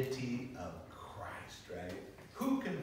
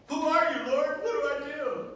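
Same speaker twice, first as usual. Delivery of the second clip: high, loud startled cry; terrified Saul; 12 semitones higher, 11 dB louder than usual. Acted. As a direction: cry out in alarm to the Lord. Saul struck down, crying out.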